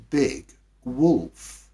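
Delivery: usual speaking voice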